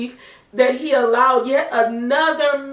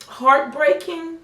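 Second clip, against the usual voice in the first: ordinary talk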